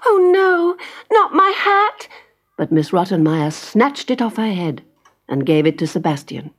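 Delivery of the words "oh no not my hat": high, loud, dismayed cry; distressed little girl. Acted. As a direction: plead for the hat. Heidi cries out in dismay.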